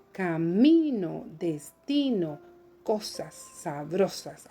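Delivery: slow, leaning on "Camino", "destino", "sabrosas"; stressed rhyme words. Shown as a demonstration. Teacher stresses rhyme words slowly for the class. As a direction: stretch each word and hit its stressed vowel. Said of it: "el acento lo he pronunciado".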